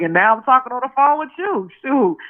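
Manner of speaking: high-pitched, pleased retelling; smiling, geeked. Retold or imitated. Retelling her phone chat with [name], smiling and geeked. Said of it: "So now I'm smiling".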